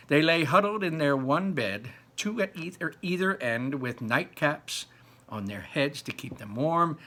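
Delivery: lively storybook narration; reading to children. Acted. Teacher reading Charlie and the Chocolate Factory to pupils, storybook narration. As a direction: read it out to the class with energy. Reads the book aloud to class.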